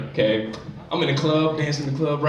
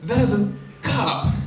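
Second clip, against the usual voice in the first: deadpan; 4 semitones higher, much slower than usual. Retold or imitated. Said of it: "And I go".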